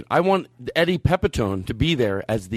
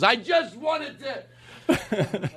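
higher, very slow, laughing; Eddie Pepitone; 9 semitones higher, 4 dB quieter, much slower than usual. Retold or imitated. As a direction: drag it out through a laugh. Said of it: "He goes".

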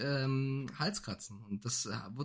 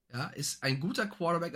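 usual speaking voice

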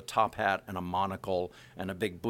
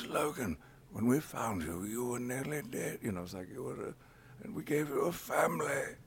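put-on aged, frail older Professor X; lightly voiced elderly mentor; a hint of an old man pleading; old Patrick Stewart; 3 semitones higher, 3 dB quieter than usual. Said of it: "I had to age him up a bit".

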